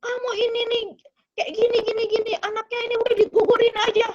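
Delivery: high, agitated retold argument; quarrelling spouse. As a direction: act out a heated marital row. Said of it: "Terus dia belum berantem lagi".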